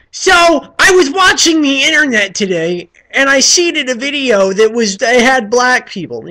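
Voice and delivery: loud, high, drawn-out dumb vlogger voice; hammed-up shouty idiot kid making an opinion video; parody of clueless video kids. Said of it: "not one of those kids".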